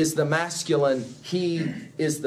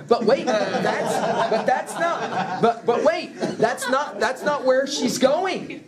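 higher, laughing "but wait"; giggling double take; laughing confusion; 6 semitones higher, slower than usual. Acted. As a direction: crack up as the joke turns on you. Groundlings realise they were misled.

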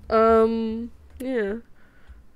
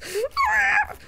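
high-pitched delighted squeal. Real, not acted. Streamer hearing praise of their voice, high delighted squeal.

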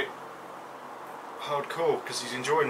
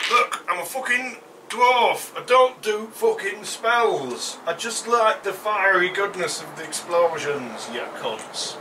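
brash dwarf; 5 semitones higher, 4 dB louder than usual. Acted. Playing dwarf character.

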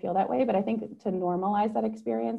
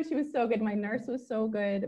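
ordinary talk, nothing marked

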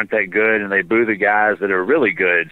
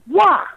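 a sudden, much higher, louder "Wah!" cry; crowd's wah cry; 4 dB louder than usual. Retold or imitated. Bar crowd celebrating the chugged beer, loud high wah cry. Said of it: "they make this sound and they go".

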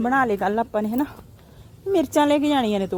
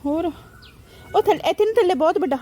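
excited pointing; 8 semitones higher, 4 dB louder, faster than usual. Real, not acted. Excited pointing at big brinjal.

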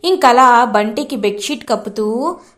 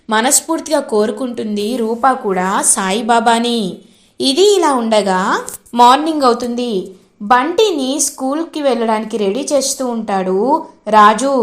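ordinary delivery

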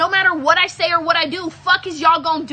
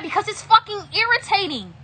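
irritated, higher-pitched retort; sounding mad; 6 semitones higher than usual. Real, not acted. Streamer explaining the anger to viewers, irritated. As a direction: push the pitch up in real exasperation. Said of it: "Well, you sound so mad".

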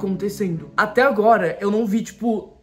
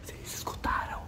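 whispering; 14 dB quieter than usual. Real, not acted. Hears a noise, frightened.

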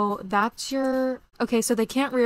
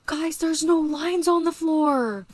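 higher, louder and slow, stretched out in delight; amazed and thrilled; 8 semitones higher, 6 dB louder, slower than usual. Real, not acted. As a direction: savor every word in amazement. Amazed at game update.

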